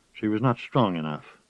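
ordinary delivery, nothing marked

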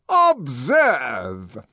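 loud, triumphant proclamation. Acted. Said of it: "said the director triumphantly".

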